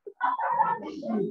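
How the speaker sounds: sudden high laugh at his own slip; high burst of laughter. Real, not acted. Preacher laughing at letting slip the school name, high laugh. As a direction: laugh out at having named it.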